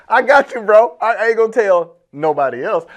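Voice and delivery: high, loud, cracking up; amused mock reassurance. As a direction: joke loudly through rising laughter. Joking reply to whispered confession.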